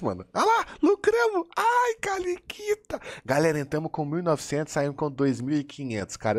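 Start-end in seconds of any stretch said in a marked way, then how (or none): 0.3-1.5 much higher squeaky cheer; squeal of joy. Real, not acted. Streamer cheers the win in a squeaky voice. Celebrating the profit. 1.6-3.0 put-on much higher, slow baby-talk coo; cute squeaky coo. Streamer coos at his winnings in baby talk. Cooing after profit.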